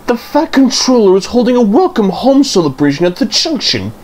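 high, bright-voiced little railcar; Daisy the railcar. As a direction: voice a chirpy young diesel railcar with news. Daisy's voice in story.

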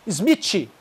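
"Smith" said as "smitchi"; "Smith" with T ending. Shown as a demonstration. English teacher showing a mistake, "Smith" as "smitchi". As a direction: end "Smith" in a Brazilian chee syllable. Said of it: "Então não é".

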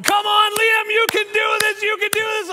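wife's relentless high-pitched yelling; imitating a frantic mother in the stands; loud cheering. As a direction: scream encouragement without letting up. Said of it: "she's cheering so loud".

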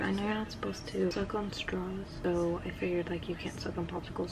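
hushed, breathy whisper; soft whisper. Real, not acted. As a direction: keep it a quiet whisper.

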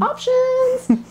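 excited. Real, not acted. Playful reveal after frosting tips.

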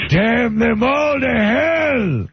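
high, slow hammed-up movie line; Charlton Heston impression. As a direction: ham it up like Heston's ape. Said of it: "When he said that line".

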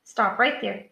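ordinary delivery

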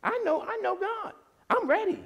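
very high, soft-edged mimicked mother; high-pitched imitation of a reassuring mother; imitating mother. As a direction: lift it high into mom's untroubled voice. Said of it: "She was talking like this".

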